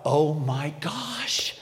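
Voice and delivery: breathless whisper, very slow; whispered realization. Retold or imitated. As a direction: whisper it as the truth dawns. Realizing daughter did it.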